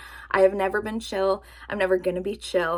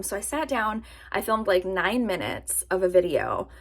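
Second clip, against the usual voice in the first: ordinary delivery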